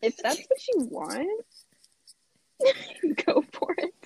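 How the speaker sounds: high, laughing mock approval; supportive mock. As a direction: play along with a giggle. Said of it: "was about to be like".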